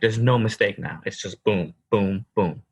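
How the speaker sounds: ordinary delivery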